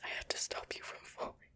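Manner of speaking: sleepy, half-awake whisper; drowsy voice. Acted. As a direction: mumble it like just waking. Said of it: "said in a very drowsy voice".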